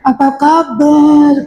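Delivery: slow "Apa kabar" through pursed lips; pursed-lip greeting. Retold or imitated. Preacher mocking a woman pursing her lips, slow. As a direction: greet with lips pushed short. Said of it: "bibir dipendek-pendekkan".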